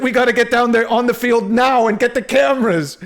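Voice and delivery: high, loud hurried producer voice; frantic news producer. Pretend. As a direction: rush the crew out like a panicked boss.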